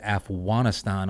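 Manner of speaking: Afghanistan said as Af-wah-ni-stahn, with a soft w; Afghan pronunciation. Retold or imitated. Relaying how Afghans they know say it, Afghanistan as Af-wah-ni-stahn. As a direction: soften the g of Afghanistan into a w. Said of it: "they don't even say that".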